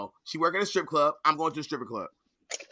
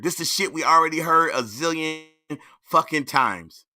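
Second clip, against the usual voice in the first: ordinary delivery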